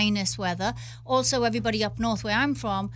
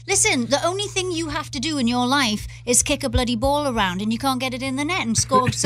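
loud, higher-pitched telling-off; exasperated rant; 3 semitones higher, 5 dB louder than usual. Acted. Ranting at soccer players who can't score, exasperated. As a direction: scold them, building in pitch and volume.

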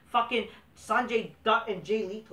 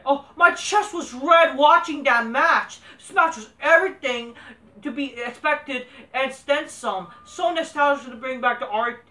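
mock fan review; 4 semitones higher, 6 dB louder than usual. Quoting a smark's review, hammed-up gushing. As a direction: read it like a swooning fan. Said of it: "this is actually a smart that's saying this".

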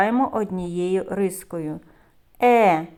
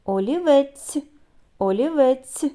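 drawn-out word; 5 semitones higher, 3 dB louder than usual. Shown as a demonstration. Teacher helping children hear the sounds, drawn-out "Олівець". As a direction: draw out "Олівець" so each sound is heard. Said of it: "Вимовляємо слова протяжно".

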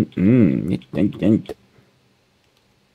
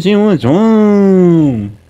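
mocking voice; 8 semitones higher, 4 dB louder, much slower than usual. Mocking quote, drawn out.

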